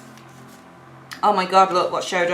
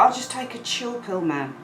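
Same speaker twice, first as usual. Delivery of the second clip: calm; 2 semitones higher, 8 dB quieter than usual. Acted.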